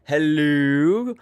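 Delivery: ordinary talk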